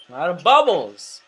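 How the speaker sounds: loud, rising swell on "bubbles"; alarmed outburst. Real, not acted. Gamer realizing the move has run out, alarmed outburst.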